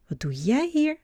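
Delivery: put-on a boy calling out in surprise at the door. A narrator's reading. Mats finds Adam at the door and calls out, high and surprised. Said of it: "roept Mats".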